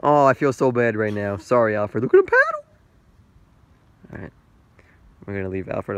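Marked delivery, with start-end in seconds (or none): 2.0-2.7 high, loud squeal; loud excited squeal at the duck; delighted squeal. Real, not acted.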